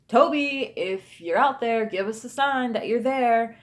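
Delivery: imitating a husband challenging a ghost, loud; calling out. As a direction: call out high and firm to someone unseen. Retold husband calls out to a ghost.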